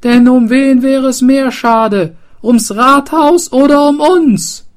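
high, loud rallying cry; rousing shout. Acted. Mayor to the townsfolk, high rallying shout. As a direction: fire up the crowd.

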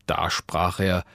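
ordinary delivery

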